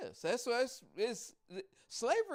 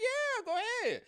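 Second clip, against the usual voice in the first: high, louder, mock-eager urging; eager go-ahead; 6 dB louder than usual. Woman urges the man to buy, high and eager. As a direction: urge him on in a much higher pitch.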